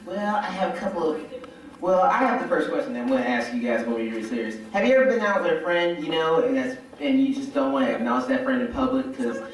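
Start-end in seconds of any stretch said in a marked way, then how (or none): none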